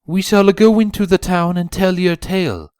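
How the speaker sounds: confident zebra voice. A narrator's reading. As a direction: speak with sure confidence. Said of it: "with a confident smile".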